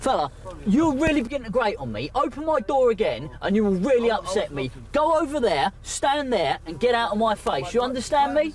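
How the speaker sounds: firm angry officer voice; stern angry scolding. Real, not acted.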